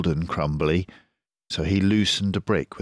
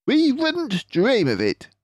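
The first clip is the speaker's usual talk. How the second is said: clever fox leader, pleased with himself; Mister Fox voice; 5 dB louder than usual. Acted.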